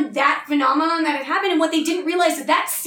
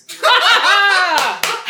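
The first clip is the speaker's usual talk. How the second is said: big burst of laughter; loud laugh; 5 semitones higher, 7 dB louder than usual. Real, not acted. Laughs at wrist break.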